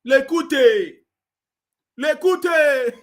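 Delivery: mocking call. Real, not acted. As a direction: call him out with scorn. Calling name mockingly.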